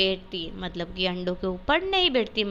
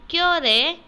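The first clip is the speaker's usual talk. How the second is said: sharp, higher, louder "क्यों रे?"; mother scolds her son, higher and louder; scolding mother; 7 semitones higher, 6 dB louder than usual. Acted. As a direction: snap at the boy like a cross mother. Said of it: "माँ ने केशव से पूछा".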